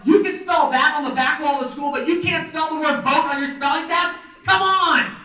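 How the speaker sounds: loud, high-pitched comic exasperation; exasperated rant. Retold or imitated. Teacher fuming to himself about student's spelling, exasperated loud rant. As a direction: rant in disbelief, building to a shout.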